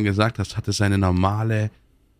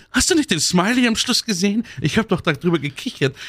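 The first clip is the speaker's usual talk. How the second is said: high-pitched, indignant excuse; mock defensive protest; 8 semitones higher than usual. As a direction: protest innocence in an exaggerated high voice. Acted defensive reply about the smiley. (gespielt empörte, hohe Rechtfertigung)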